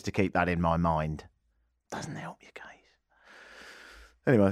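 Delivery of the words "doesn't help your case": whispered aside. Real, not acted.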